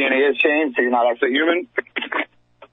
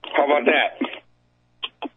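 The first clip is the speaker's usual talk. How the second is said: crackly cordless phone; much slower than usual. Real, not acted. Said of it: "That's a little bit better".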